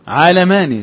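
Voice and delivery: عالماني said slowly with the first syllable open, ʕaa-lamani; نطق عالماني بالفتح لا بالكسر; pronounced with open a. Shown as a demonstration. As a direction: open the ع in عالماني, not علماني with i. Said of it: "تنطق هكذا".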